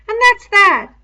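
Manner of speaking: high, loud, stubborn insistence. A narrator's reading. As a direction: dig your heels in on every word. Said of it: "said Piggy stubbornly".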